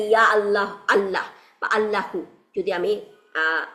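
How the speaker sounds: normal talking voice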